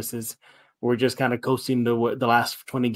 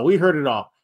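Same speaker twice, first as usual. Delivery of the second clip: normal talking voice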